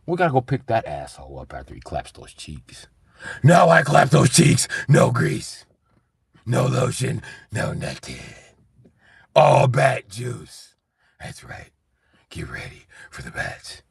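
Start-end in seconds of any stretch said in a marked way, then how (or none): 3.4-10.7 loud, slow gloating bat-man boast; boastful Batman. Retold or imitated. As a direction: brag it out slow and loud like a cartoon hero.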